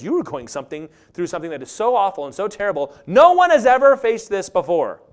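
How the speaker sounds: mock devil's whisper rising to a loud cry; devil's voice building. Preacher voicing the devil's lie, building loud.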